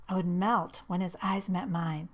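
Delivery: ordinary delivery, nothing marked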